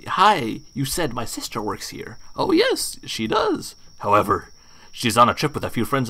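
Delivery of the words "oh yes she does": very high put-on innkeeper voice; high character voice. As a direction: squeak it out as the odd host. Voicing bathhouse owner character.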